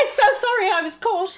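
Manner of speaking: high, hammed-up sorry voice; mock a guilty politician; mock apology. Mocking Boris's apology.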